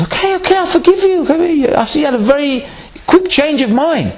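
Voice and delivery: high, lightly voiced owner granting forgiveness; a hint of an amused pet owner; owner forgiving. Joke owner forgiving the parrot.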